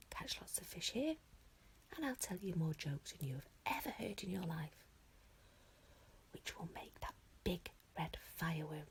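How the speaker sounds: soft conspiratorial whisper into an ear. A narrator's reading. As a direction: hush it, just for the dragon. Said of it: "he whispered into the little dragon's ear".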